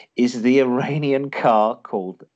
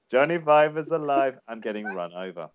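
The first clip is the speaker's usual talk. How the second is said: put-on Indian accent, dropping away at the end; mock film-robot line in an Indian accent. Quizmaster quoting the Short Circuit robot, Indian accent. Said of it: "did that in an Indian accent".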